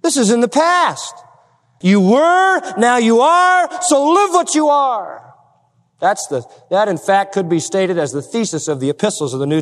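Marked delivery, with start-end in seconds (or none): 0.0-5.2 high, emphatic pulpit voice; full-force preaching. Real, not acted. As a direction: ring out each short phrase, higher. Preacher drives the point home.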